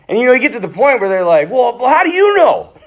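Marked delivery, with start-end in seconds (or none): 1.5-2.7 put-on irritated kids. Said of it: "They get so pissed".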